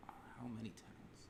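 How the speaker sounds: slow unvoiced whisper. Real, not acted. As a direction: breathe the question. Whispered echo of question.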